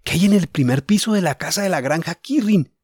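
thinking it through with excitement; Julián thinking. Acted. Julián's thoughts voiced.

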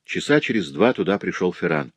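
plain reading voice

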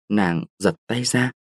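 usual speaking voice

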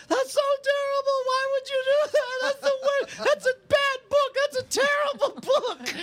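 very high imitated child's wail; mock howling cry. Retelling the daughter's outrage at the book, mock howling. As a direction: wail it like a heartbroken little girl, hammed-up. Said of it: "cries and howls and says".

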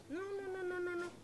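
high, insistent no no no; high-pitched emphatic refusal. Real, not acted. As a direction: brush the idea off firmly. Emphatic denial of visitors' motive.

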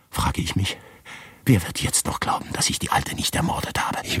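hushed dread of arrest; fearful whisper. Acted. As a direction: whisper as if someone might overhear.

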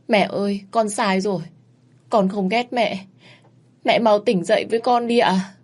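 high tearful pleading; child sobbing over mother's coffin; weeping. Acted. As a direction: beg mother to wake. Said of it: "khóc òa lên".

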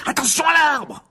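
loud, high alarmed shout; urgent high-pitched cry; cried warning. A narrator's reading. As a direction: yell a sudden warning. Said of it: "s'écria Harry qui plongea sur le volant".

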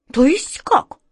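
high-pitched sudden indignant question; startled, bristling. A narrator's reading. Wizard suddenly roused by the boy's reproach, startled. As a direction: flare up in disbelief. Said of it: "вскинулся вдруг волшебник Алёша".

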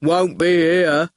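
slow, heavy goon voice. Acted. Goon stands over Howard, slow reproach. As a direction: drag the words out as a lumbering heavy.

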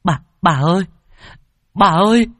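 high, frantic calls for grandma; calling out loudly. Acted. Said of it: "luôn miệng gọi lớn".

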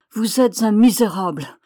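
loud, emotional accusation; indignant outcry. A narrator's reading. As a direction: cry out with contempt, barely holding it in. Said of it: "s'écria-t-elle". (cri d'indignation)

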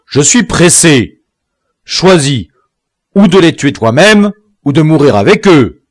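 an impatient chief giving an ultimatum; impatient command. A narrator's reading. Biassou orders the colonist to choose, loud and impatient. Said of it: "d'un ton d'impatience et de colère".

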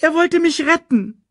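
put-on very high, plaintive child; wailing protest. A narrator's reading. Simba explains the death to Scar, wailing. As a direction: wail it in self-defence. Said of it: "klagte Simba".

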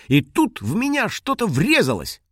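high, animated little dragon; excited high-pitched storytelling; animated retelling. Acted. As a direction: tell the mishap with alarm. Little dragon retells her crash.

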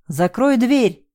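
high, slow drawn-out shout; shouted order. A narrator's reading. Man under fire shouts at her to shut the door. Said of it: "кричал мне Тимур".

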